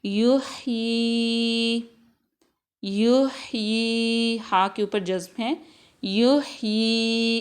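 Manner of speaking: युही with the ई held very long; lengthened vowel. Shown as a demonstration. A tajweed teacher elongates the madd in युही. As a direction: stretch the ई in युही slowly. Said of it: "या को हम लंबा इसलिए कर रहे हैं".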